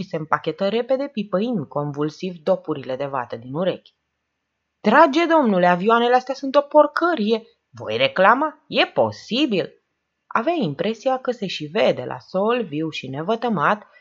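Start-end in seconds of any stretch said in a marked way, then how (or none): 4.8-9.7 high, loud ranting complaint; indignant outburst. A narrator's reading. Old man imagines storming an office over planes, indignant. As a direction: make a scene, outraged. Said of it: "făcând scandal".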